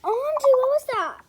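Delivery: high, loud startled cry; startled shout. Acted. Daughter hearing a crash in the night, startled shout. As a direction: jump at the noise and shout it.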